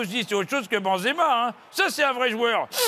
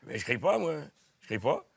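much lower and softer protest; comic low mumble denying; low denial; 10 semitones lower, 7 dB quieter than usual. Acted. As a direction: insist quietly, deep and hushed. Denies shouting, in low voice.

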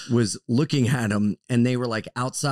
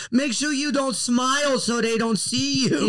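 mock kid voice; 10 semitones higher than usual. Mock child advice, laughing.

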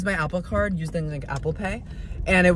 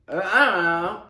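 ordinary talk, nothing marked

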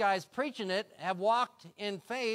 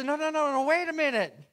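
loud, high eager protest; insistent no; 9 semitones higher, 9 dB louder than usual. Acted. David insisting to his brothers, loud and high. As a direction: push back, desperate to be heard. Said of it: "He says".

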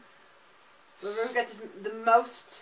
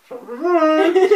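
louder, laughing through a full mouth; 3 semitones higher, 10 dB louder than usual. Real, not acted.

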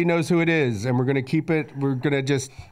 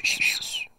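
whisper rap sound; 4 dB quieter than usual. Retold or imitated. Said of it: "It's a whisper sound".

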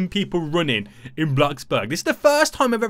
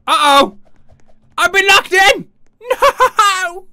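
high, loud alarmed cry; loud high laughing alarm; panicked yelling; 11 semitones higher, 8 dB louder, slower than usual. Real, not acted. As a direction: yell in mock panic, laughing. Thinks he's locked in.

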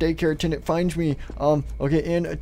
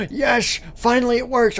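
gasp, then a high cheer; excited relief; 7 semitones higher than usual. Acted. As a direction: celebrate finally getting it to work. Robot head finally works.

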